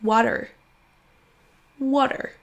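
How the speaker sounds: American water with a flapped t and r ending; American water, wadder; American-style water with an r-coloured ending; American accent. Shown as a demonstration. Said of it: "Ou pour l'accent américain".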